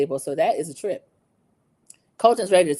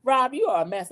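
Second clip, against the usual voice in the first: ordinary delivery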